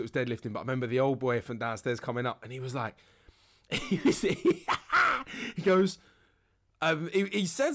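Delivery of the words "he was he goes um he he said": high giggle breaking through the words; laughing. Real, not acted. Remembering the old boy coming up, laughing helplessly. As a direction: crack up before you can finish. Cracks up retelling the anecdote.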